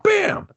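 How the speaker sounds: a sudden high "Bam!" punch sound effect; mock punch noise. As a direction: shout out the hit like a cartoon.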